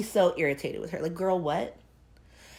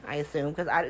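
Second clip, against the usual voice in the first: ordinary delivery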